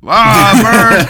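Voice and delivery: high loud silly voice. Pretend. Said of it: "he's like".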